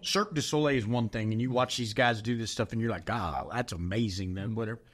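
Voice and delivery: ordinary talk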